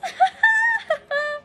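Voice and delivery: high, teary laugh; real tearful laugh. Real, not acted. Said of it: "I just got done tearing up".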